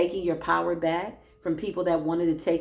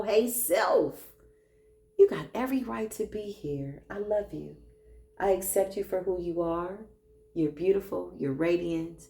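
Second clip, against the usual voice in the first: hug yourself and speak kindly; self-love affirmation. Acted.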